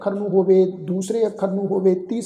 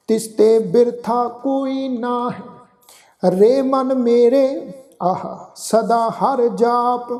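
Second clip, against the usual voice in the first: slow, devout chanting, each phrase drawn out; gurbani recitation; 3 semitones higher, much slower than usual. Acted. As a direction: chant the verse with reverence.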